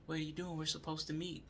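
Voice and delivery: a retold girlfriend pestering him about where he is, in a high mock voice; imitating girlfriend. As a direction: mimic the nagging girlfriend up high. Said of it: "She's like".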